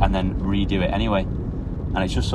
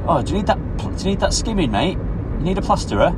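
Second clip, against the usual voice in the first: high, sing-song put-on voice; customer's chummy offer; 10 semitones higher than usual. Customer offering the barber a plasterer, chummy high voice. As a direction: imitate an eager tradesman touting for work.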